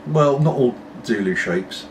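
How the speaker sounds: ordinary talk, nothing marked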